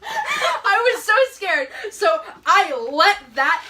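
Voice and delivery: high, loud, slow wail through laughter; drawn-out words breaking with laughter; laughing. Real, not acted. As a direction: squeal it out while cracking up. Cracking up recalling being scared.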